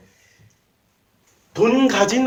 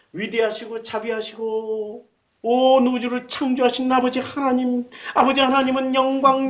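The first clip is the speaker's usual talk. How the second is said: higher, lofty churchy prayer voice throughout; pompous prayer; 5 semitones higher than usual. Retold or imitated. Mocking how a religious show-off prays to God. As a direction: pray grandly, performing piety for show. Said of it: "탄식을 하라고 그랬더니 종교인".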